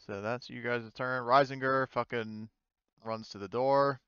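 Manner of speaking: normal talking voice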